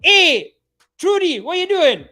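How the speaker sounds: put-on high, loud Jamaican accent call; Jamaican sketch voice. Sketch character greeting Trudy, loud Jamaican accent. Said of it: "West Indian Jamaican sketch".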